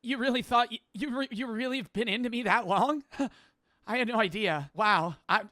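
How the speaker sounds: mock an anime girl being confessed to; flustered anime girl. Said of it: "sitting down being like".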